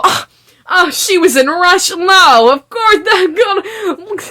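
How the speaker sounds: high, airy mock dismissal of a trip to Russia. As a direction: toss it off lightly in a high voice, mocking. Mocking the characters' casual reaction.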